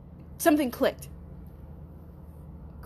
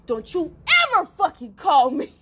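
retold angry order; 6 semitones higher, 5 dB louder than usual. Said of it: "I said".

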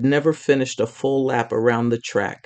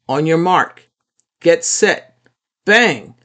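rising countdown, louder to the bang; race announcer; 4 semitones higher, 6 dB louder, slower than usual. A narrator's reading. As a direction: build the start to a loud bang.

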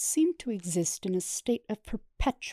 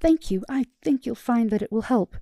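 much higher and louder, overjoyed; beaming; 5 semitones higher, 9 dB louder than usual. Acted. Therapist beaming at Starscream's agreement, overjoyed. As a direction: thank him with delight. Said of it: "The therapist beamed".

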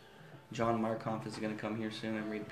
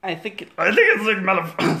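high, loud, rushed put-on voice; fake nervous voice; 9 semitones higher, 14 dB louder than usual. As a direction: slip back into the strained voice. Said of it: "sorry, I did it again".

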